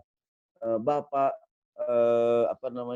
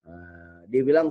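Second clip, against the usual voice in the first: ordinary talk, nothing marked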